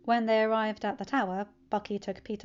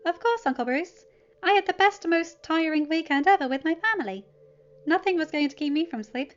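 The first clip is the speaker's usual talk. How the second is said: put-on high, louder, bright-eyed teen voice; cheerful teen; 8 semitones higher, 7 dB louder than usual. Teen telling his uncle about his weekend, cheerful and high. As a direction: bounce through it like an eager kid.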